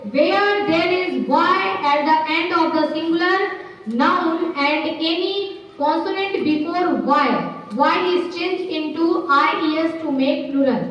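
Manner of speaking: very slow, word by word dictation; slow classroom dictation of a grammar rule; dictating a rule. Acted. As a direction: read the rule slowly so the class can write it. Teacher reads grammar rule aloud.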